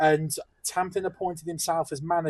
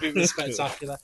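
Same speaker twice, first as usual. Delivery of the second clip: ordinary talk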